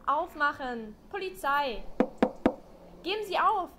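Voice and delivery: laut und bestimmt an die Tür rufen; lauter Polizeiruf. Acted. Polizistin ruft an der Tür. (laut und energisch rufend)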